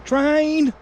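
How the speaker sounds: high call of train; first call, not loud enough yet. Real, not acted. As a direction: call it out to the family. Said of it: "that weren't loud enough".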